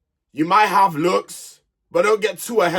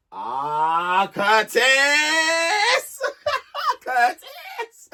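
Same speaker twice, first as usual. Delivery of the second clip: drawn-out high cry breaking into laughter; laughing wail; 6 semitones higher, much slower than usual. Real, not acted. Vlogger exasperated at Curtis, laughing wail. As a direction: wail the name, then crack up.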